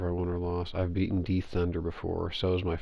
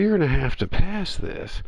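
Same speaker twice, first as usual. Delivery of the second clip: higher, louder smug retort; mocking opponent; 11 semitones higher, 5 dB louder than usual. Retelling the opponent's reply, raised mocking voice. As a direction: throw the dare back, cocky.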